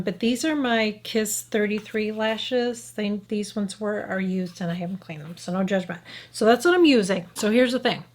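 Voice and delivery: normal talking voice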